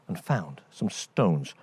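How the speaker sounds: usual speaking voice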